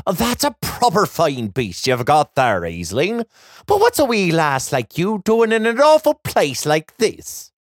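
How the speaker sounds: put-on old Irish leprechaun voice; kindly Irish lilt, puzzled. A narrator's reading. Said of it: "Mister McGrady pats Clover's flank".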